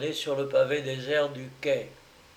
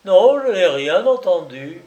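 raised, higher, firm denial; louder repeat; 4 semitones higher, 10 dB louder than usual. Acted. The narrator repeats his denial louder before the man's fury. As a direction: say it again, louder and firmer. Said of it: "je répétais plus haut".

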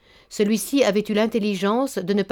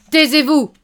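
loud, higher, thundering shout; thunderous voice; 7 semitones higher, 9 dB louder than usual. Acted. Baron roaring at Nantas on his daughter's name, loud. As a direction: roar it like thunder. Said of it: "cria d'une voix de tonnerre".

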